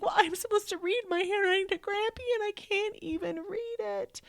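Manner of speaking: put-on high tearful kid voice; crying child. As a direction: plead it like a sobbing boy. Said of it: "He's like".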